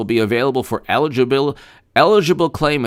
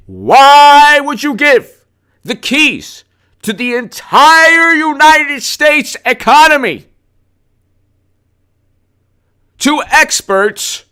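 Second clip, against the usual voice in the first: much higher, slow, sing-song mockery; mocking voice; 7 dB louder, much slower than usual. Mocking the experts who run the economy, high and slow. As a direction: drag it out high to sneer at them.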